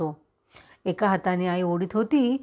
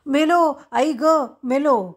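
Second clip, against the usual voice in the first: high, loud scream of fear; boy shoved into the well screaming, high and loud; terrified yell; 7 semitones higher, 6 dB louder, slower than usual. A narrator's reading. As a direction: cry out as if drowning. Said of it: "मी ओरडलो".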